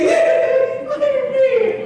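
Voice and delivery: put-on very high, drawn-out toddler squeal; excited toddler. Son spotting Spider-Man shoes, excited mock toddler squeal. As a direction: imitate a little boy bursting with delight. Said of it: "my son".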